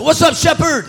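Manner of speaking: high, loud joking reply; playful mock greeting; mock pastor greeting. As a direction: fire back a churchy twist. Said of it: "I didn't say that".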